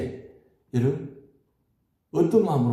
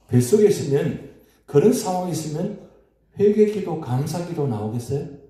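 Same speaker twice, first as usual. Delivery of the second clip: rising preacher's cry; 5 semitones higher, 3 dB louder than usual. Acted. Preaching climax raised voice.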